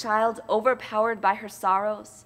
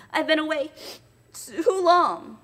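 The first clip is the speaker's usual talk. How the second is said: a hint of anxious child; 7 semitones higher, 7 dB louder, slower than usual. Nell eager to leave Quilp.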